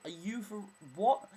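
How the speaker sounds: loud, high-pitched disbelief; incredulous blurt. Retold or imitated. Blurting back at the stranger, incredulous. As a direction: blurt it out in mock outrage. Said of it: "I just blurt this out".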